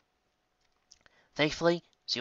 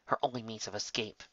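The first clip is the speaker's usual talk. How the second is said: usual speaking voice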